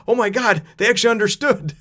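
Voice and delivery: high, loud and amazed. Retold or imitated. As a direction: gasp out the surprise. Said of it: "and then Usopp's".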